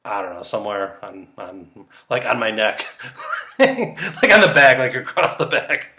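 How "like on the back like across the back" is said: giggly and higher, louder bursts; laughing at his own bet. Real, not acted. Laughing about a neck tattoo.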